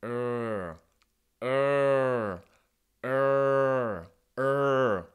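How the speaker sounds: demonstrating the American R; American R sound. Shown as a demonstration. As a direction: hold the R with the tongue back against the molars. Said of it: "et vous dites".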